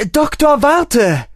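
high, loud call after someone running off; calling after him. A narrator's reading. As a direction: shout so he hears you ahead. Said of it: "rief sie ihm hinterher". (lautes Hinterherrufen)

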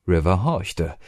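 usual speaking voice

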